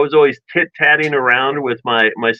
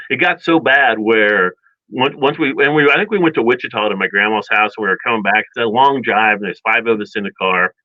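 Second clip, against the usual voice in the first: ordinary talk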